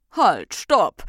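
loud, commanding shout; called out. A narrator's reading. Recht breaks up the party, loud call. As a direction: call a sharp halt. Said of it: "rief das Recht".

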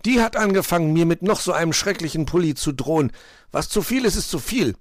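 high-pitched, aggrieved protest; indignant self-defence. A narrator's reading. Quarrelling friend blames the other for starting it, indignant. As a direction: protest loudly that it isn't your fault. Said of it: "verteidigte sich Puh".

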